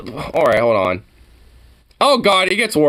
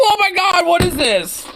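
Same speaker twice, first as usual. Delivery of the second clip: outraged disbelief; 13 semitones higher than usual. Real, not acted. Outraged reaction to the clip.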